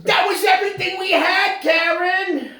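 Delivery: high, full-throated anguished shout; hammed-up desperate yell; Ray Liotta. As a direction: scream it like a movie gangster losing it. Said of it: "He sounds so much like, um, Ray Liotta".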